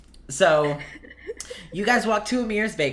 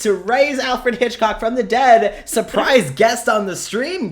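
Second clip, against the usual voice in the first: loud, high showman's proclamation; mock grand announcement; 5 semitones higher, 7 dB louder than usual. Game master announcing a surprise guest to the stream, loud and grand. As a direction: boom it out slowly to the crowd.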